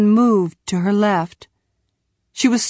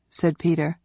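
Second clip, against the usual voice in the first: ordinary delivery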